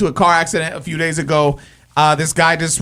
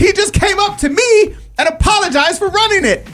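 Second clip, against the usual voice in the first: indignant outburst. Real, not acted. Outraged at the other driver's lie.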